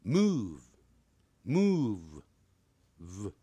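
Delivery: "move" drawn out slowly, ending on a buzzed "v"; sounded final v. Shown as a demonstration. Teacher showing students the ending, slow careful pronunciation. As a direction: stretch "move", then voice the "v" alone. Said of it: "这样把v念出来".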